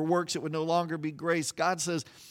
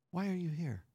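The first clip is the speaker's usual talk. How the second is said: softer, hushed voice of God; barely above a breath, gently; still small voice; 9 dB quieter than usual. Retold or imitated. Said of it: "in that still small voice".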